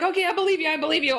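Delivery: high-pitched, startled reassurance; facing a sudden apparition, high and startled. Retold or imitated. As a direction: blurt it out high in shock. Startled by a manifested being.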